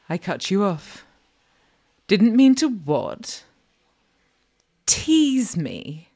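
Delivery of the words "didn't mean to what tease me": loud, pointed demand; challenging interruption. A narrator's reading. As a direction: cut them off with a loud, raised challenge. Cuts off the apology, challenging.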